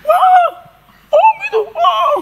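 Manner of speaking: yelling woman. Retold or imitated. Masseuse on the beach screams at the man going under, high shout. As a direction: shriek it as if someone vanished in the waves. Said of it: "dia teriak-teriak".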